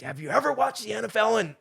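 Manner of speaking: high, heated scolding; chewing him out. Retold or imitated. To the teammate who keeps confessing, high heated scolding. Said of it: "I just chewed him a new one".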